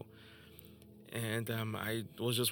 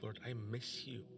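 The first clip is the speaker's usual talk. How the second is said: retold tearful prayer; 10 dB quieter, slower than usual. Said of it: "and I was just crying".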